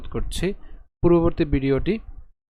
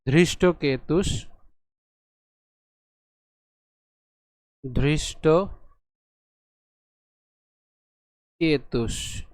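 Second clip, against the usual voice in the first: ধৃষ্টকেতুষ very slowly, split after ধৃষ্ট; broken into parts; much slower than usual. Shown as a demonstration. Teacher breaking the word up, slow separated ধৃষ্টকেতুষ. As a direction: draw out ধৃষ্টকেতুষ piece by piece, ending on the sh. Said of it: "একে একটু ভেঙে লিখি".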